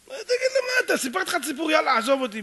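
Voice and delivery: very high, loud protest; acted indignant rant; exasperated outburst. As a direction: blow up at the nosy stranger. Crying man explodes at odd question.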